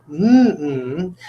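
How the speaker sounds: two hummed beats, mouth shut; hummed syllables. Shown as a demonstration. Teacher humming "chicken" in two beats. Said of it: "You can hum it".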